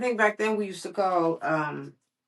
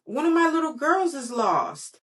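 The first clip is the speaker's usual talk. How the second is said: worried teacher; 8 semitones higher, 3 dB louder than usual. Acted. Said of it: "said a voice that sounded like Missus".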